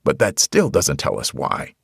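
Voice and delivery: ordinary delivery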